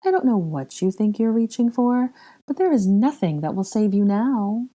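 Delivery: sugary villainous condescension; mock sympathy. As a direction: pity the captive with relish. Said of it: "in a voice of mock sympathy".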